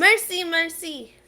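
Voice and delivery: playful merci. Acted. Playful French words.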